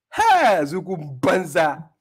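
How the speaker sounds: slow, drawn-out jeering shout; mocking shout. Real, not acted. Mocking a rival debater with a slow shout. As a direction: hurl the insult across the room. Insulting opponent, shouted mockery.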